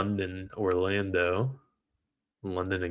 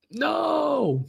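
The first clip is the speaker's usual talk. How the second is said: anguished no. Real, not acted. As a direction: shout it as the trade turns. Trade going wrong live.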